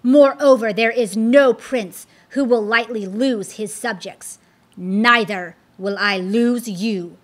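loud snarling fiend, hitting each I hate; hateful declaration. Acted. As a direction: spit out his hatred of the king. Apollyon declaring his hatred.

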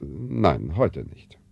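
curt refusal. Acted. Father curtly refuses dinner.

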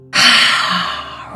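strong rush of breath, tailing away; full sighing exhale. Shown as a demonstration. Guide letting shoulders drop and sink, full sighing exhale. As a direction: sigh all the breath out. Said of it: "Really, really, really breathe it out".

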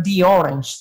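"the orange" said "di orange"; the before a vowel, said "di". Shown as a demonstration. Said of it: "la E del T H E suena".

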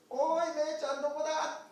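high, loud calling across the hills. Acted. Shepherd calling loudly for the lost lamb. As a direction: shout out to find the lost lamb.

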